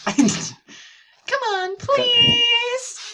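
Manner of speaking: drawn-out, wheedling please; pleading. Acted. Sayori coaxes a friend to the club, pleading. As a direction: beg with a high, stretched-out please.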